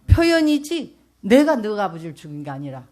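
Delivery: loud, stressing 표현이지; emphatic repeat. Acted. Preacher repeats God's words to the congregation, loud and emphatic. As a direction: drive 표현이지 home with force.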